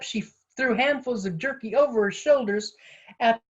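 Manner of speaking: plain reading voice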